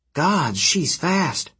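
slow, drawn-out wonder; amazed. Acted. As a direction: marvel at her speed. Hazel amazed.

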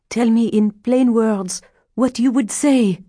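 high, insistent woman's voice; urgent demand. A narrator's reading. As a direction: press hard for an answer, high-pitched. Adele wildly demands an answer.

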